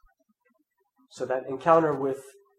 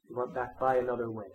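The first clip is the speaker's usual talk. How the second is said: softer, reflective repeat; quiet; 2 semitones lower, 6 dB quieter than usual. Shown as a demonstration. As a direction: let the line settle quietly. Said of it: "As that text tells us".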